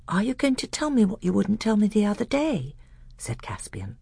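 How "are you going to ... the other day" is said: put-on higher, eager boy's voice; a young prince speaking eagerly. A narrator's reading.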